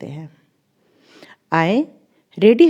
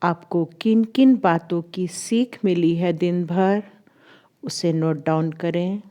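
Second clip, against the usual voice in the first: guided meditation; 2 semitones lower than usual. Acted. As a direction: ease them into stillness, drawing out the English cues. (धीमी, ठहरी हुई ध्यान कराने वाली आवाज़)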